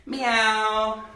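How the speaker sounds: playful mock meow for the kids; cat meow. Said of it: "can you be a cat".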